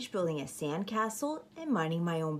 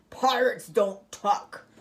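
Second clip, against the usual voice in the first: louder, slow pirate refusal; pirate captain; 5 dB louder, slower than usual. Acted. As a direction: refuse it flatly like Braidbeard. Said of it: "he bellowed".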